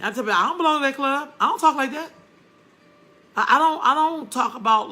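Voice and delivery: heated, rising protest; emphatic insistence. Real, not acted. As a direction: push back hard.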